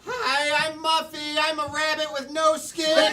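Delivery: high, loud squeaky creature voice; giggling skinless rabbit; creepy rabbit voice. Acted.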